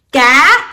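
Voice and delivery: CA sliding upward, loud; Vietnamese rising tone. Shown as a demonstration. Teacher shows Vietnamese tones, rising CA. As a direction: say "Ca" with the pitch climbing. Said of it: "seven tones in our language".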